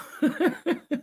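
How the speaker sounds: light amused titter; high giggle. Real, not acted. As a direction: giggle at the idea. [name] carrying a screwdriver.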